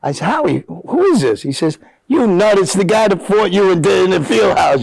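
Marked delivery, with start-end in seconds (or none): 0.3-1.4 high-pitched retold question; puzzled phone retort. Player to old friend on the phone, puzzled. 2.1-4.8 high-pitched mock exasperation; teasing exclamation. Old friend reminds him of their fieldhouse fight, teasing exasperation.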